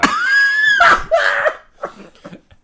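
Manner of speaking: loud, high-pitched belly laugh; burst of laughter. Real, not acted. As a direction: explode laughing at the story. Reacting to the big dude's line.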